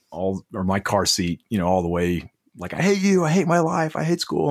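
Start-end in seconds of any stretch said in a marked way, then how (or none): 2.7-4.5 mock the little girl's tantrum cry; imitating angry child. Dad imitates his kindergartner yelling from the car seat. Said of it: "she's kicking the back of the car seat".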